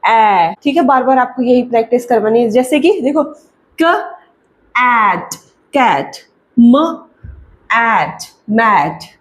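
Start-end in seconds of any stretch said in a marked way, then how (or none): 3.8-9.1 each sound stretched out very slowly; slow phonics blending. Shown as a demonstration. Phonics teacher stretching sounds slowly for parents. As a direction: draw out the vowel before saying the word. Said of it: "इस sound को extend करना है".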